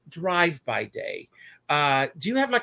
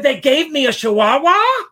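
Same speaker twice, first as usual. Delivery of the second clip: much higher, much louder disbelieving cry; shocked punchline; 8 semitones higher, 12 dB louder than usual. Acted. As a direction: sell the punchline with outraged surprise. Punchline of the blind-man joke.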